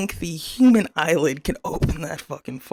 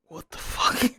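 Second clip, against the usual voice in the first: shocked whisper; 4 semitones lower than usual. Retold or imitated. Retelling own shocked reaction.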